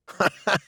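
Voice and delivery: a quick light chuckle; short laugh. A narrator's reading. Dickon amused by Mary's count of five, light chuckle. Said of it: "laughed Dickon".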